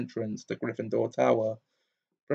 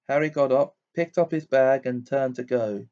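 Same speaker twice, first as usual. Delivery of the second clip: usual speaking voice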